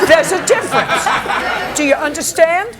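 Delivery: laughing through the words. Real, not acted. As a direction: scold while still laughing. Judge laughing while scolding.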